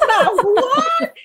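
high, loud incredulous retort; hammed-up affronted squeal, laughter around it; mock disbelief. Daughter reacting to mother's remark on her accent, mock-incredulous.

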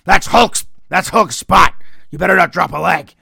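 loud mock reprimand; screamed scolding. Imagined scolding of Morocco for tearing his shirt, loud mock yelling. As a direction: yell it forcefully, warning someone off another's turf. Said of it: "got screamed at for tearing his shirt".

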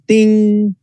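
high sung ting of a ring bell; bright mock bell chime; bell ding. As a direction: ring it out like the first-round bell. Imitating the opening bell.

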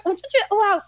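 normal talking voice

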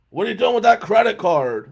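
acted-out confrontational clerk; hotel clerk's loud challenge. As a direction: call it out loudly, accusing the guest. Acting the gruff hotel clerk.

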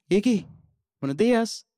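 high, loud sing-song good morning; bright wake-up hello to a pet; cheery wake-up call. Retold or imitated. Boy waking his pet iguana, high and cheery.

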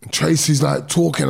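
normal talking voice

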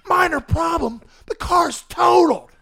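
high, loud, crying shout; exasperated mom yell. Retold or imitated. Mom yelling at dad that the car is totaled, loud wail. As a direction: wail it at him in disbelief.

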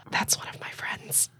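hushed embarrassed whisper. Acted. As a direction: whisper it in shame.

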